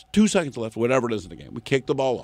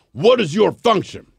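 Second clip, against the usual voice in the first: slow, higher, louder demand; crotchety official; 8 semitones higher, 6 dB louder than usual. Retold or imitated. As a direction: bark it like a suspicious old guard. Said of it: "his words are, quote".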